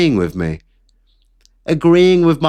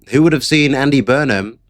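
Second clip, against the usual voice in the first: ordinary talk, nothing marked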